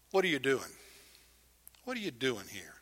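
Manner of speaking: preacher softly turning God's question on the congregation; quiet echo. Shown as a demonstration. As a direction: ask it gently to each listener. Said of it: "the question comes to me, as it comes to you".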